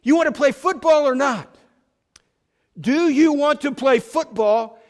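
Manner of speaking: loud, high demanding shout; acted coach shouting at a player who missed his block; angry shout. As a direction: yell the question right in his face. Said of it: "He looks at Petey and he says".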